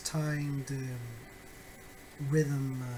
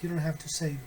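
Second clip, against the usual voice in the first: ordinary delivery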